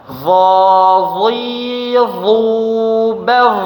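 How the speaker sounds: "dhi" and "bagh" said thick and rising; heavy dhad drill. Shown as a demonstration. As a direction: run the heavy set crisply. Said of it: "Kita coba". (dhad tebal berurutan)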